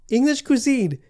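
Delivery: indignant. Real, not acted. Outraged ranking rant.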